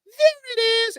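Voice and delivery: squeaky high cartoon bird; high-pitched silly bird voice. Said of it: "undoes his wings and goes".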